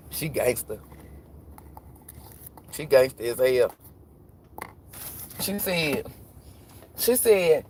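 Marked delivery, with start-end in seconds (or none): none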